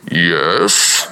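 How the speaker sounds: very deep booming God; Darth Vader-like voice of God. Acted. God answers the screaming man, deep Darth Vader-like voice. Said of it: "And he hears this voice".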